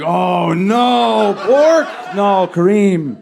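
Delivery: rising from shock to a high shouted "pork?"; mock horrified gasp. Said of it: "we'd all be like".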